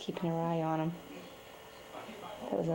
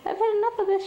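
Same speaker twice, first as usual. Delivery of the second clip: a lightly voiced fed-up cat, high; voicing the cat; 12 semitones higher, 6 dB louder, faster than usual. Mother cat voiced as fed up, high huffy tone. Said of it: "she says".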